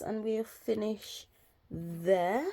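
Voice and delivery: soft, breathy ASMR murmur. Shown as a demonstration. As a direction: ease into a gentle ASMR hush. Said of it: "little A S M R voice".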